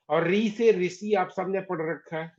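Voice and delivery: ordinary delivery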